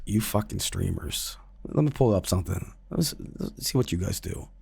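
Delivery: low, soft, contemptuous mutter; menacing intruder. Retold or imitated. Intruder about to show the streamer proof, quietly menacing. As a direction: play a sinister stranger, hushed.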